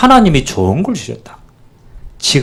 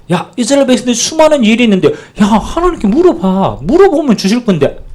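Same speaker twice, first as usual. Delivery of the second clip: higher, fast, pressing urge; urgent plea of God; 9 semitones higher than usual. Acted. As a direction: push them, almost pleading, to just ask. God urging Israel to ask.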